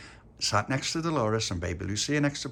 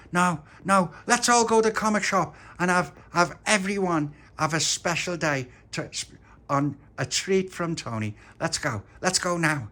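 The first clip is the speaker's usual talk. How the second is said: put-on eager boy; 9 semitones higher, 4 dB louder than usual. Tony eager for comic shop.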